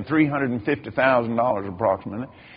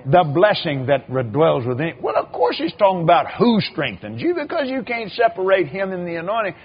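usual speaking voice

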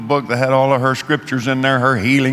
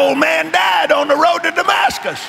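much higher, ringing preacher's cry; preaching shout; 13 semitones higher, 3 dB louder than usual. Acted. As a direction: lift it to a triumphant shout. Preaching climax, congregation responds.

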